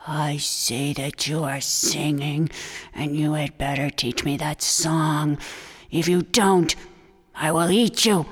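low, menacing coyote voice; low coyote voice with growing threat; threatening coyote. Acted. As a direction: threaten the turtle, low and dark.

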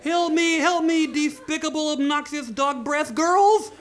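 high, loud cry for help with insults; shrill-pitched dwarf yelling, last word stretched out; pleading dwarf. Acted. Dwarf calling to the girls to save him, high desperate yell.

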